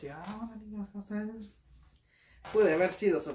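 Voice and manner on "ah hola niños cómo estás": higher, hushed hello; soft greeting to children. Retold or imitated. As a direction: reenact a small-voiced hello to kids. Greets his ex's children in story.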